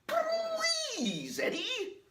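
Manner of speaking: extremely high, squeaky cartoon rabbit; Roger Rabbit impression. Vlogger doing a cartoon rabbit, squeaky and high. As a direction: squeal it like a panicky cartoon bunny. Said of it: "rabbit impressions".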